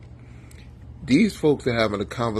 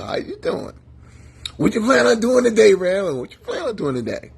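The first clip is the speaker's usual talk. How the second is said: mock cheerful deflection; 10 semitones higher, 5 dB louder than usual. Voicing himself with mock politeness.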